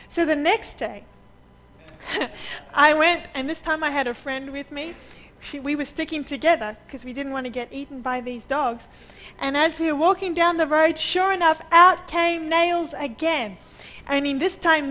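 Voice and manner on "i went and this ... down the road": ordinary talk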